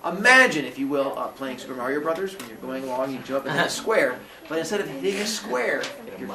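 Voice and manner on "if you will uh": normal talking voice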